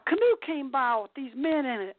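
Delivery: ordinary talk, nothing marked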